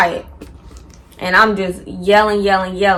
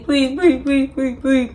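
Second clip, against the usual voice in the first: higher, whiny imitation of him begging; mock begging; 5 semitones higher than usual. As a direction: beg on your knees, half-mocking. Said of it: "like, on his hands and knees".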